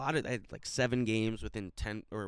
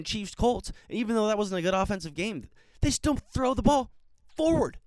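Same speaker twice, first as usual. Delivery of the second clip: higher, louder furious ranting; angry rant; 9 semitones higher, 3 dB louder than usual. Real, not acted. Podcaster raging at his team's coach, angry shouting. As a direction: vent at the coach's dumb calls, shouting. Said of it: "I sounded angry".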